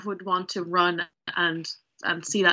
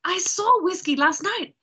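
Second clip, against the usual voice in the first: higher-pitched, eager revelation; breaking big news, pitch raised; excited, in character; 10 semitones higher than usual. Acted. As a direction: blurt out a wondrous discovery to an old friend. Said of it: "I'm gonna say".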